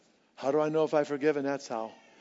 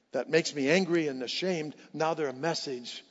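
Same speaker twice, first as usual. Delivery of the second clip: ordinary talk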